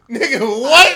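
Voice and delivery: outraged shout. Real, not acted. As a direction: shout it in disbelief through laughter. Outraged reaction to coworker.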